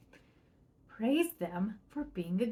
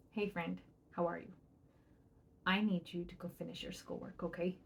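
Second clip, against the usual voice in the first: put-on soft, gentle ask to a child; kind directive; 3 semitones lower, 9 dB quieter than usual. Shown as a demonstration. Parent gently asks child to do schoolwork, soft and kind. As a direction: coax them kindly, much softer. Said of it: "Then you kindly give them a directive".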